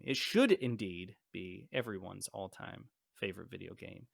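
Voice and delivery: usual speaking voice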